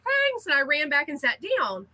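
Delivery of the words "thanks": high, hurried little thanks; a breezy thanks while dashing off; quick chirpy thanks. Retold or imitated. Grabbing her award from Karen, a high, hurried thanks. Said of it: "I was like".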